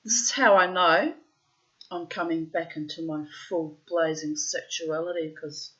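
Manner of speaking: ordinary talk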